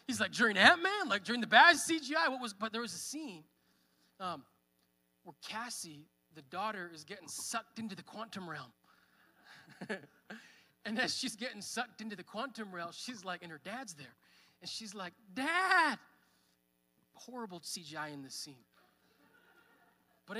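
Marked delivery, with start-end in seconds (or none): 0.3-2.5 loud, high mock disbelief from the brother; incredulous brother. Brother teasing him over crying at Ant-Man, loud incredulous mockery. 15.3-16.0 high, loud mock cry of "Dad!"; daughter's cry. Retelling Cassie calling for her father, a high loud mock cry. Daughter pulled into quantum realm.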